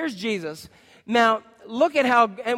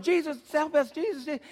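pleading crowd; 8 semitones higher, 3 dB quieter than usual. Pretend. Crowd pressing in on Jesus.

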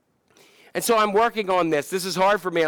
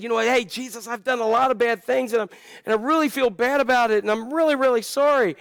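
repentant criminal; 7 semitones higher than usual. Retold or imitated. Criminal voiced confessing to Jesus.

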